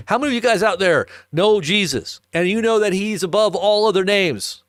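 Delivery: fervent sermon delivery; preaching. Acted. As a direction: proclaim it to the congregation. Preaches at rising force.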